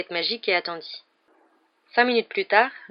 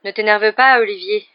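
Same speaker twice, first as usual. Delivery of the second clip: plain reading voice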